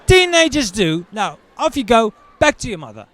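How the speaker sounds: loud, high, shooing her off; doctor waving them off; dismissive doctor. Retold or imitated.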